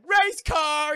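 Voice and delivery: high, shouted first words; excited blurt. Retold or imitated. Patient says first words for doctor, loud blurt. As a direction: blurt it out at full volume.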